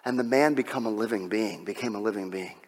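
low, very soft scripture reading; hushed awe. Real, not acted. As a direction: let the verse sink to a hush.

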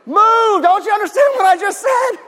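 high, loud, exasperated yell at the stepdad; hammed-up shout to hurry him up; mock angry yell. Yelling at slow stepdad.

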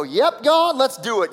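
hammed-up keen yes-man; mock eagerness. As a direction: pretend to jump at the offer. Acts out an over-eager Moses.

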